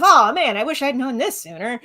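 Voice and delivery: high, hammed-up wail of regret; mock regret. Co-host imitating regretful viewers of the stream, mock-lamenting. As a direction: playfully lament a tip learned too late.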